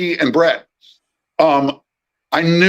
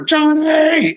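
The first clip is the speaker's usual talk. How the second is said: high, bright call of a name; excited greeting call; 14 semitones higher than usual. Retold or imitated. Old boss running over to greet his assistant, excited shout. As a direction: call out his name across the yard, overjoyed.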